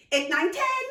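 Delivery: put-on high, loud boy's voice; child finishing count. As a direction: finish the count in a triumphant rush. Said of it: "concluded Nico".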